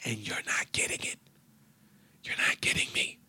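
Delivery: teasing whisper; whispered taunt. Acted. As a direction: whisper it with a sly edge.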